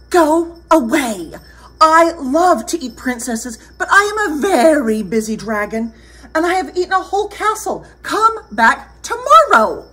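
high, forceful dragon voice; high-pitched storybook dragon, dismissing a visitor; dismissive busy dragon. Acted. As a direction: send them off as a busy, self-important dragon.

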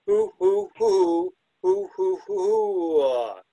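high, loud hooting owl call; loud owl hoots. Shown as a demonstration. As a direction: hoot it out like a barred owl. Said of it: "you could probably make a case for saying".

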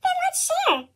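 put-on high little boy voice; a small boy's eager offer, pitched high. A narrator's reading. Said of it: "A little boy said".

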